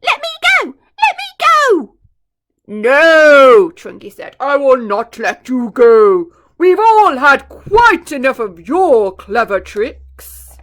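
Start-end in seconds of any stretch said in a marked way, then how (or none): 0.0-2.0 very high, much louder panicked yell; crocodile yelling. Acted. Dangling crocodile begging the elephant, high panicked yell. 2.7-3.7 much louder flat no; firm elephant shout. Acted. Chunky refusing the crocodile, booming no. 4.4-10.6 slow, much louder, booming telling-off; stern elephant scolding. Acted. Chunky scolding the crocodile, slow booming telling-off.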